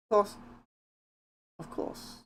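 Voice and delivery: lightly voiced prim transatlantic lady; a hint of a refined, proper voice; Rarity. Streamer testing short lines, lightly put-on prim voice. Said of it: "lines to say in the Rarity voice".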